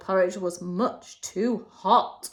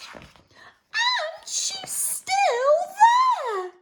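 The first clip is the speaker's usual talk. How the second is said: very high, slow, drawn-out cub cry; Baby Bear shouting; much slower than usual. Acted. Baby Bear crying out at the sleeping girl. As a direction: squeal the discovery, slowly.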